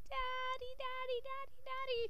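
high, repeated whine for Dad; scared pleading. Acted. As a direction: call for your father over and over, frightened. Frightened child keeps calling father.

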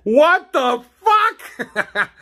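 very high, very slow scared shriek; mock terrified. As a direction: shriek it as a startled houseguest. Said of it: "You just, mm".

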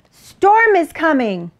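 high, slow warning cry; barked warning. A narrator's reading. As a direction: call the alarm out as the dog, drawing it out. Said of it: "barked Dog".

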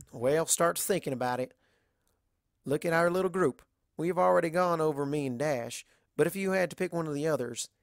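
lightly voiced Southern farm mare, frank and friendly; level with her like a blunt country friend; a hint of a drawling Southern mare, teasing; Applejack voice.

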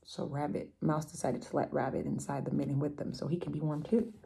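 usual speaking voice

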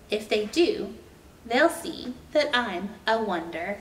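gentle, expressive storybook reading; reading to children. A narrator's reading. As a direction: read it like a bedtime picture book. Reads picture book aloud.

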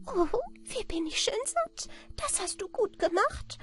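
very high, squeaky, pleased voice; tiny creature, full and satisfied, piping away; contented little man. A narrator's reading. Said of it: "Es saß zufrieden".

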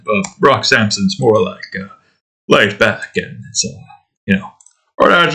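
normal talking voice

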